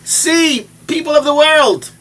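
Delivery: slow, high, loud proclamation; booming divine call; voice of God calling. Retold or imitated. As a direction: call out to the whole world. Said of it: "HaKadosh Baruch Hu to the world".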